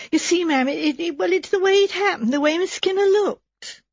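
a worked-up maid struggling to start; stammering flustered maid. Acted.

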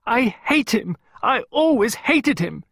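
high, loud outburst of hatred; hysterical shout. A narrator's reading. Pippa shouting about Oliver to Clarissa, hysterical. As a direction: shout like a child near hysteria. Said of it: "only response was to shout".

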